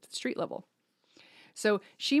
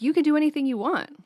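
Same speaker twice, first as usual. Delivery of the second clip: mock exclaim; 8 semitones higher, 6 dB louder than usual. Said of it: "I'm like".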